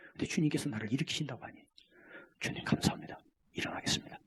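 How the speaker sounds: soft whispered thanks; whispered prayer. Acted. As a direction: pray it under your breath. Humble believer's prayer. (속삭이는 감사 기도)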